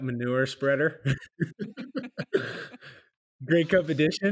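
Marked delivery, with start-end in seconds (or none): 1.1-2.8 laughing. Real, not acted. Joke about Grey Cup spreader.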